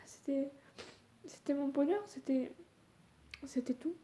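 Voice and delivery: high, breaking, tearful voice; crying through the words; snotty crying. Real, not acted. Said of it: "Je suis désolée si je pleure".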